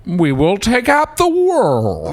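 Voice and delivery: high, very slow, drawn-out movie line; dubbed MacArthur. Acted. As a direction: stretch it out like a badly dubbed general. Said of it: "MacArthur's there going like".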